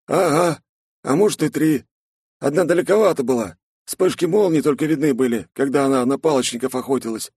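put-on young buddy voice. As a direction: ask like a curious friend. Snegir voiced.

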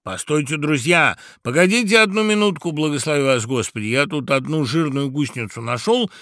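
animated bird begging a delay; woodpecker answering. A narrator's reading. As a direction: call out to hold off the crowd. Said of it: "отвечал дятел".

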